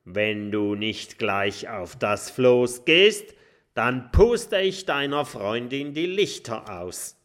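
pirate threatening the dragon; menacing threat. Acted. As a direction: threaten him holding a knife. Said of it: "ruft Kokosnuss zu".

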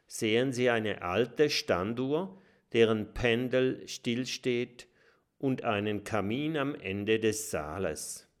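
ordinary delivery, nothing marked

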